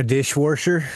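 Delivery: "dishwasher" said as "dish-worsher", questioning; added R. Retold or imitated. Friend testing grandma's other words, imitated added R. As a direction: ask "dishwasher" with an R in wash. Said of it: "She'd say Washington?".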